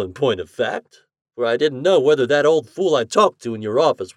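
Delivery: lightly voiced older doctor, eager; a hint of a brisk doctor greeting a guest. A narrator's reading.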